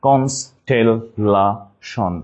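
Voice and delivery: very slow "constellation", each syllable apart. Shown as a demonstration. Teacher spelling for class, "constellation" split slowly by syllable. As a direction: break "constellation" into separate syllables, pausing between. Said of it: "just একটু ভেঙে নিব".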